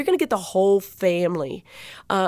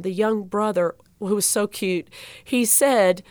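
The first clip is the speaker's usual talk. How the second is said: ordinary talk, nothing marked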